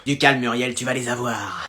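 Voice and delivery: voix intérieure. Acted. Voix intérieure très basse.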